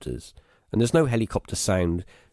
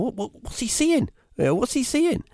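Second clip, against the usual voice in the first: put-on much higher, impatient kid's question; burn with curiosity like a kid left out; eager wondering. Kids wondering what dad sees.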